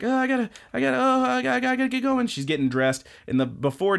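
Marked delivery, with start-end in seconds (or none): 0.0-2.3 high, stammering rush; high flustered girl running late; hurried anime girl. Retold or imitated. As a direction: trip over the words, high and hurried. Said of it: "she's like".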